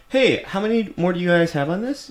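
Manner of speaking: high, sweet polite voice; overly polite ask. Retold or imitated. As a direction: play the nice gym guy. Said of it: "I said the very normal sentence".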